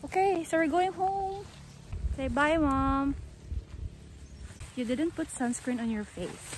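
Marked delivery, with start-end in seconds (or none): none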